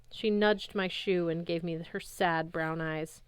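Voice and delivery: plain reading voice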